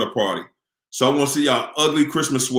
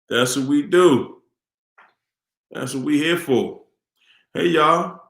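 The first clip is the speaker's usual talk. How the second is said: normal talking voice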